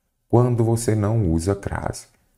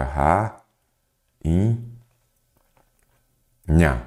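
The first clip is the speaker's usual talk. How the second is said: "ra, in, nha" with long pauses between syllables; sílaba por sílaba; much slower than usual. Shown as a demonstration. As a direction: say "ra, in, nha" one syllable at a time. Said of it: "vou separar em sílabas". ("ra, in, nha" separado em sílabas, com pausas)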